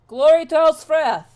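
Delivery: high, very loud battle salute; full-voiced hail, pitched high; rallying cry. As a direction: shout the house salute out across the field. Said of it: "stops here and kinda looks and goes".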